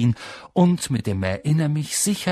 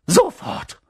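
very high, sharp command; stern professor; 4 dB louder than usual. A narrator's reading. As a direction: order it with no room for argument. Professor orders Harry to follow. (hoher, scharfer Befehl)

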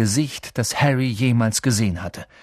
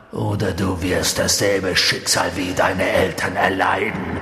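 low Voldemort threat; 7 semitones lower than usual. A narrator's reading.

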